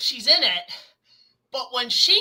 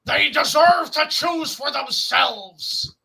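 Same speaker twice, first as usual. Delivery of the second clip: Optimus Prime; 3 semitones higher, 4 dB louder, slower than usual. Retold or imitated. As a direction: declare it like a noble robot leader. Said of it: "Optimus and Megatron going".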